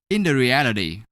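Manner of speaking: normal talking voice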